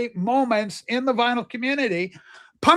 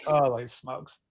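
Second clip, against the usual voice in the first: awed whisper; 10 semitones lower, 6 dB quieter, slower than usual. Real, not acted.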